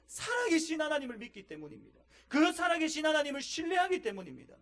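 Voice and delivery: a pastor thundering conviction; impassioned preaching. Real, not acted. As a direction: preach it with full fire. Preaching at full force.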